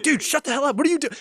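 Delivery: high, loud retold panic; alarmed scolding. Friend cutting off a dangerous joke, acted alarmed scolding. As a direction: urgently shut your friend up.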